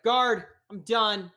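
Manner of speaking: loud, slow summons; calling the guard. Acted. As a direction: call out, done with this conversation. Calls out to the guard.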